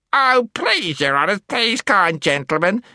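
very high, pleading whimper; squeaky, pitiful pleading; whimpering gnome. A narrator's reading. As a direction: beg in a tiny creature voice. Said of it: "whimpered the gnome".

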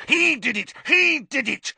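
very high, furious screech; frantic accusing cry; spat accusation. A narrator's reading. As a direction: spit it out, high and hysterical. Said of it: "Filch spat".

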